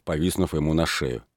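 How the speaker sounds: plain reading voice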